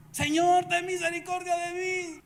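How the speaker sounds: high, loud imploring cry; begging cry for mercy. Retold or imitated. Woman keeps crying after Jesus.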